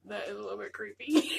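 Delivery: giggling creeped-out remark; laughing. Real, not acted. Laughing at doll.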